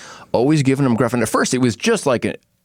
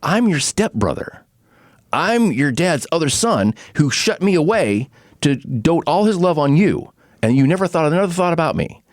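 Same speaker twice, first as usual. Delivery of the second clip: higher, earnest half-shouted plea; Will Scarlet revealing he is the stepbrother, impassioned; impassioned confession; 8 semitones higher than usual. Retold or imitated. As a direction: pour out the resentment then rally him. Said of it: "And he's like".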